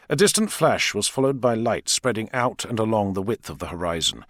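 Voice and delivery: usual speaking voice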